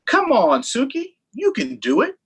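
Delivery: a hint of a cheerful girl; encouraging girl. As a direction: cheer the dog on. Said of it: "said Eleanor".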